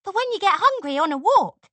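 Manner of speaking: bright, high and loud. A narrator's reading. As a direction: say it cheerfully bubbly. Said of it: "she explained brightly".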